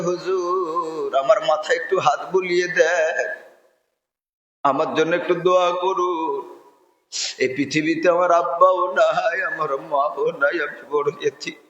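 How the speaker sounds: a hint of a crying boy begging for a blessing; tearful orphan boy. Orphan boy pleads tearfully with the preacher, softly fading. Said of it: "একটা ছেলে কাঁদতে কাঁদতে এসেছে".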